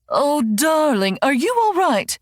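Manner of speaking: high, slow, doting mum voice; fussing mother. A narrator's reading. Mother kneels by her sick daughter, high doting coo.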